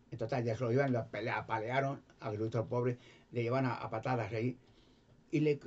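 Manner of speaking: normal talking voice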